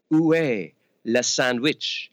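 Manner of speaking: slow, careful "Où est le sandwich?" in halting French; clumsy tourist French. Retold or imitated. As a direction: spell out "Où est le sandwich?" like a beginner. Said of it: "I say".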